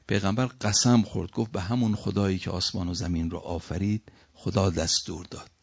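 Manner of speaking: ordinary talk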